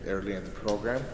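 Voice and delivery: usual speaking voice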